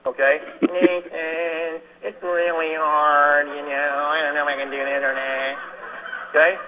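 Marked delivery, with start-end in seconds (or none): 0.6-5.6 slow, whiny complainer voice; whiny nasal voice. Retold or imitated. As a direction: whine it like a quitter. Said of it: "Don't call me with that nasal voice".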